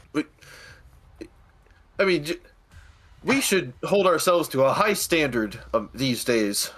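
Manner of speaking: nervous junior insisting on principle; stammering young steward. Acted.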